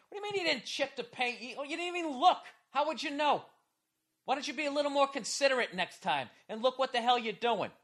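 high, heated scolding; angry driver scolding a careless woman; indignant rant. Retold or imitated. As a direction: tell her off with outrage.

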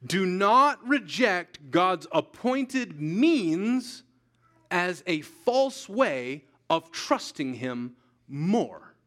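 slow, every word stressed; slow emphatic repeat. Shown as a demonstration. Preacher repeating his warning to the church, slow and weighty. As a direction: drive it home slowly. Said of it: "Let me say that again".

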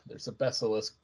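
usual speaking voice

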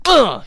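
loud, high grunt as if kicked hard; mock grunt of pain. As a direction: belt out the grunt of a blow landing. Imitating a brutal kick.

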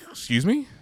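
high shocked excuse me. Retold or imitated. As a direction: sound caught off guard. Retold shocked reply.

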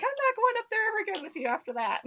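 put-on high, fast, upset girl voice. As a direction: blurt it out like a frightened cousin. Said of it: "she's like".

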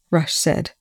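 ordinary delivery, nothing marked

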